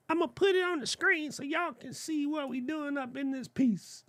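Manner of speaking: high, soft put-on voice; goofy squeaky aside; cartoonish falsetto to the viewers; silly high voice. Playful aside while sharing screen.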